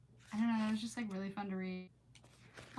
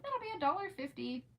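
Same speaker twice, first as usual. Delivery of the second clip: cashier quote; 8 semitones higher than usual. Retold or imitated. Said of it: "and he was like".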